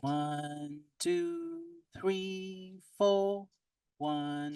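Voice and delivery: comb-filtered robotic counting; hollow phasey processed voice; metallic voice filter. Shown as a demonstration. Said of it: "Hear how bad it sounds?".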